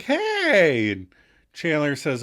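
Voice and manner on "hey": high, loud returned hello; acted sweet greeting. Smiling Kathy greets Chandler back, high-pitched. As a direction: answer in a raised, lilting voice.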